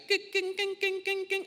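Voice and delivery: high clanking imitation; ratchet clank. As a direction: mimic the lift chain clicking. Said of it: "And it's like".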